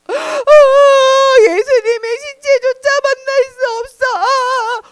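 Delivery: high, hammed-up wail; acted loud weeping of a heartbroken woman; loud crying. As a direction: bawl the words in one long sob. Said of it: "더 큰 소리로 울었어요".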